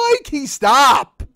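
high, loud, slow drawn-out plea; mock pleading. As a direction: stretch it out like a begging groan. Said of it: "I'm like".

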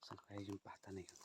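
breathy, hushed whisper. Real, not acted. Whispering while searching.

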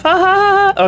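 high mock "ha ha"; put-on laughter, evenly spaced; fake laugh. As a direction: force out a high, staccato laugh. Mock laugh at the funny game.

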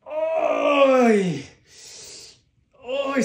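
sharp high cry of effort; loud relieved groan. Real, not acted. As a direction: let out a loud pained ay after the set. After finishing the heavy set.

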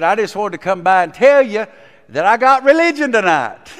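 eager nephew announcing his salvation; excited news. Retold or imitated. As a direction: tell her he got religion, beaming. Joyful news to his aunt.

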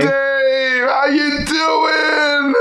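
drawn-out, high, delighted hello; delighted greeting. Real, not acted. Welcoming the rapper [name] onto the track, slow delighted greeting.

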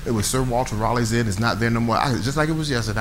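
normal talking voice

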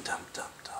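hushed tense whisper. Real, not acted. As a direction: breathe the numbers softly. Whispered tension at scores.

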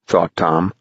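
plain reading voice

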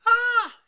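put-on high, very loud boyish scream; horrified scream. A narrator's reading. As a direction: scream out in shock. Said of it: "he cried".

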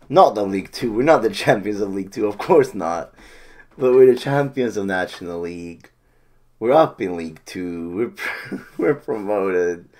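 loud, fast elated babble; loud giddy celebration. Real, not acted. Fan still elated after the title, loud giddy talk. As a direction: still buzzing, talk over yourself. Correcting himself while celebrating.

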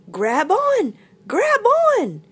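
high, loud rescuer's call. Acted. Sun bear lowering branch to animals, urgent call. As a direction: call down into the hole.

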